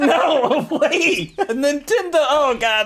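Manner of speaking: high, drawn-out protest through laughter; hammed-up frantic wail, cracking up; laughing mock panic. Teller replaying his mistaken weed request, laughing mock panic.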